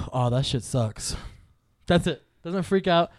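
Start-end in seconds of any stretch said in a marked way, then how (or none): 0.1-1.1 mild unbothered remark. Retold or imitated. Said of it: "he goes".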